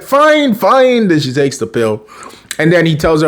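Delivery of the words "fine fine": high, mock-exasperated surrender; exasperated giving in. Mom gives in to her son, high mock exasperation. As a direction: imitate a mom relenting, pitch thrown up.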